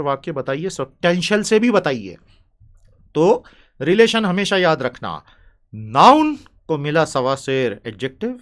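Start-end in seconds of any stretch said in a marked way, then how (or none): none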